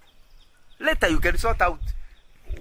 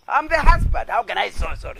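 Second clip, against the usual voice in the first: mock proud husband; 6 semitones higher, 3 dB louder than usual.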